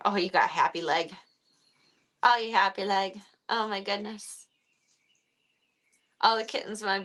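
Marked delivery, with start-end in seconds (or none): none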